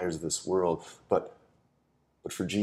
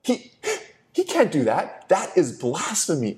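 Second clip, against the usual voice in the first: high, loud indignant outburst; scandalised protest, voice jumping up; outraged leaders; 11 semitones higher, 6 dB louder than usual. Retold or imitated. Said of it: "they're like".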